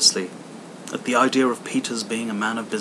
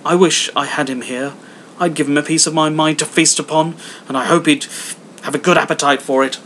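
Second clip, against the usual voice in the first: higher, loud heated outburst; wife fuming about Scrooge, higher and loud; angry wife; 5 semitones higher, 7 dB louder than usual. Acted. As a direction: flush with anger and let it out. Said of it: "cried Missus Cratchit, reddening".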